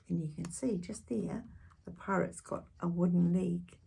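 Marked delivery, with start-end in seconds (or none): 0.1-1.4 whispering. Real, not acted. Aside to children.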